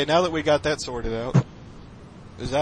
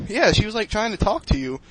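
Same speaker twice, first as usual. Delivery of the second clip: 1 eager mom; 7 semitones higher than usual. Retold or imitated. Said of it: "And she's like".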